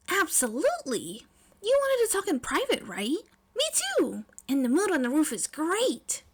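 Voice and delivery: eager schoolgirl gushing; excited May. Acted. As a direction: gush with glee, pitched high.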